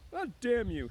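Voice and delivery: high, loud, sudden outburst of anger; angry cry. Acted. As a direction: lash out at the dead pup. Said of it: "he cried".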